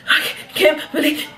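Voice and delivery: high, laughing fake sob; mock crying. Student breaking down at the counselor's office, mock crying. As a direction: blubber it through giggles. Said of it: "cried like that".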